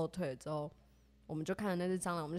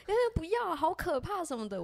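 pitched way up, wailing no; imitating frightened squeal; 9 semitones higher than usual. Friend begging not to face the cockroach, high frightened wail. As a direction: whimper like the bug might jump at you.

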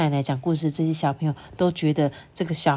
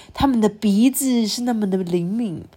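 usual speaking voice

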